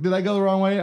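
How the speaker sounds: high-pitched confused guy; bewildered questioning. Acted. Counting man at the very end, bewildered questioning. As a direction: ask it high, baffled.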